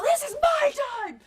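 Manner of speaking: very high-pitched re-enacted yell; imitated yelling. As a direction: yell it like a mad wrestler claiming the moment. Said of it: "I'm yelling at Joey Ryan".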